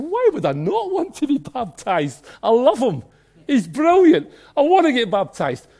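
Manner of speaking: joyful excited inner voice; eager believer. Retold or imitated. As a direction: gush like a delighted new convert. Voicing a believer's eager thoughts.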